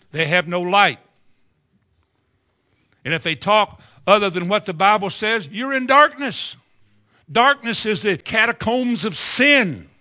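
fiery pulpit preaching; full-force preaching. Real, not acted. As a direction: thunder it at the congregation.

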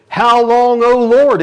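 high, loud plea to heaven; loud cry. Acted. Saints in heaven pleading with God, loud cry. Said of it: "they are crying out to the Lord".

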